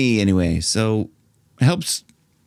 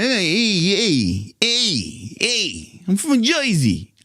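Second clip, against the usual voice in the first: exaggerated New Jersey accent, pitch raised; 5 semitones higher, much slower than usual. Coach mimicking Jersey folk to find the tone, Jersey accent. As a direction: ham up the Jersey heys.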